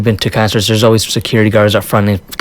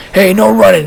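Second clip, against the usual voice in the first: police yell; 9 semitones higher than usual. Retold or imitated. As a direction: bark out the order again. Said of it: "The police officer stops and says".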